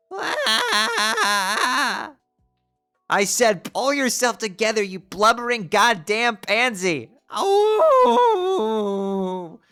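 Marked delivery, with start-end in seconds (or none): 3.2-7.1 Karkat-style yelling. Acted. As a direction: drag out the insult with contempt. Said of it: "Jade has turned into Karkat".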